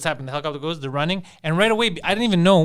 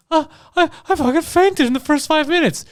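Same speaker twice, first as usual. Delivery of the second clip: high, loud breathless fainter; excited woman's voice; 12 semitones higher, 5 dB louder than usual. Retold or imitated. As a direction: gasp it out like a shocked viewer. Acted woman in promo.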